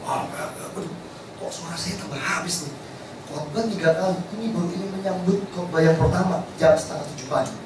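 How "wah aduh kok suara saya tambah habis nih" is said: hoarse voice. Retold or imitated.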